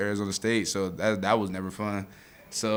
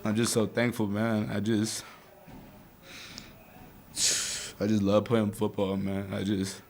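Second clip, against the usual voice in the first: crying softly; 5 dB quieter than usual. Real, not acted. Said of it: "Linebackers cry, too".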